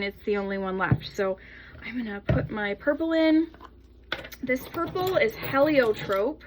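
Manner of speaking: ordinary talk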